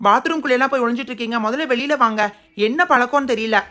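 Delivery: a scolding schoolmaster; stern teacher. Acted.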